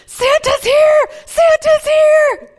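put-on high, loud, slow child squeal; excited kids shouting. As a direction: yell it like a thrilled little kid. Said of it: "kids were so excited to see her".

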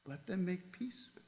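quiet second "Let them make peace with me". Shown as a demonstration. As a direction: let the plea fall away gently. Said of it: "Twice he repeated it".

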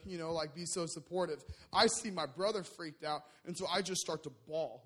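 ordinary talk

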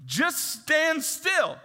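slow preacher's command; slow emphatic command. Retold or imitated. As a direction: plant each word firmly. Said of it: "I'll show you the rest".

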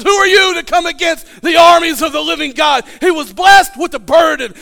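loud, high, surging sermon climax; preaching at full force. Real, not acted. Pastor rousing his church to a shouting peak. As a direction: build the congregation up to a roaring peak.

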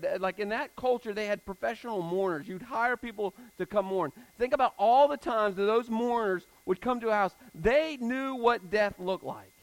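loud, high, building sermon voice; preaching at full force. Acted. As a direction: drive it home to the congregation. Preaches about the mourners.

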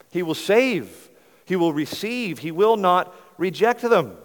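usual speaking voice

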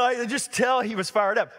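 normal talking voice

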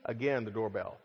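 normal talking voice

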